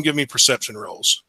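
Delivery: ordinary delivery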